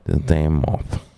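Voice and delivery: usual speaking voice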